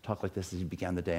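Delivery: imitating a soft-spoken professor; very quiet teacher. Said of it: "talked in very quiet voices".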